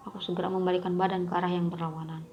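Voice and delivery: ordinary delivery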